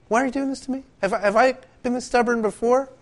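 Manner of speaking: talking donkey. Retold or imitated. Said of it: "the donkey turns around and says".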